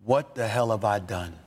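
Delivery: re-enacted private regret, low-voiced; stunned self-questioning. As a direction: drop the pitch, asking yourself in dismay. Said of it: "and I was like".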